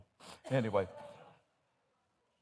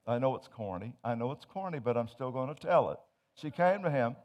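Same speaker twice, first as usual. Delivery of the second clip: normal talking voice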